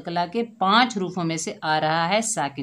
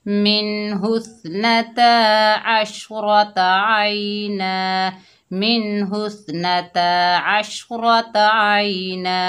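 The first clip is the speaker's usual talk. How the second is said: drawn-out, chanted tajweed reading; slow Quran recitation; 3 semitones higher, 4 dB louder, much slower than usual. Shown as a demonstration. Teacher demonstrating recitation to students, slow and chanted. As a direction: intone each syllable slowly like a Qari. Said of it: "मिलाकर पढ़ेंगे".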